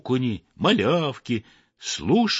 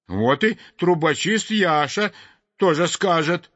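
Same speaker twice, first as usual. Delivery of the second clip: sparrow pouring out his grievance; indignant sparrow; 6 semitones higher than usual. A narrator's reading. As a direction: sparrow complaining to the sweep, outraged and high. Sparrow's continued grumbling.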